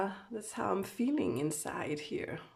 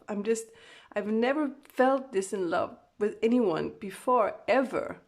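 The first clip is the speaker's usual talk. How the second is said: louder, emphatic outpouring of feeling; shaken, overwhelmed; 6 dB louder than usual. Acted. Reader voicing the Divine Masculine filled with love, overwhelmed. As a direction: let it all hit at once. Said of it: "They're, like, so shaken up".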